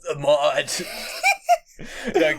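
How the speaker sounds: laughing bro voice; jock voice. Pretend. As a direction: tell it like an excited gym bro. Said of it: "brings out your jock voice".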